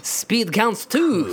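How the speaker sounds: slow, thick mock French accent; exaggerated French accent. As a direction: draw it out in caricature French English. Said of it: "I would have to say in this accent".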